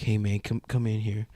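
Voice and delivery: quietly luring him in; whispered call into the closet; hushed beckoning. Retold or imitated.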